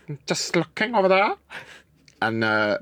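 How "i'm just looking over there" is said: put-on high innocent old lady voice; Nine. Aunt spying on the neighbour, high and innocent. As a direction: say it like a nosy aunt caught watching. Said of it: "She goes".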